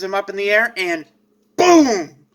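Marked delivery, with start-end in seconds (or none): none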